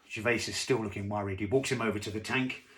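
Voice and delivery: usual speaking voice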